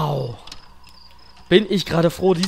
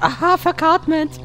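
slow, high hammed-up pun; silly joke voice; 11 semitones higher, 4 dB louder, slower than usual. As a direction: drag out the pun goofily. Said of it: "der Witz war nicht gut".

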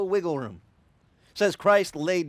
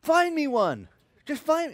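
higher, louder playful shout; women shouting back; 11 semitones higher, 6 dB louder, slower than usual. Retold or imitated. Wedding guests shouting back at the pastor, higher and louder. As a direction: call out "Find me one" from the crowd. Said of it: "started saying".

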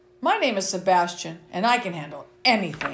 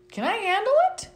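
pitched way up, rising question; high questioning refrain; 11 semitones higher, faster than usual. Acted. Reading to kids, refrain asked high and rising. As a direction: ask it high like a curious kid. Child narrator asks the refrain.

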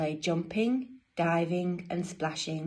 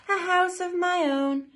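high, louder storybook delight; delighted penguin; 11 semitones higher, 5 dB louder than usual. Acted. Dudley in his own house, high delighted exclamation. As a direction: proclaim it high and proud.

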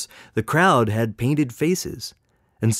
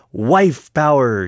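loud, slow proud exclamation; exclaimed; 3 semitones higher, 6 dB louder, slower than usual. A narrator's reading. Woman clown proclaiming her cause, loud proud exclamation. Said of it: "she exclaimed".

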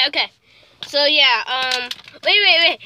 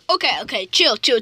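ordinary delivery

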